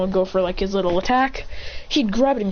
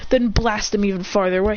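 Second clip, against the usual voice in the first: ordinary delivery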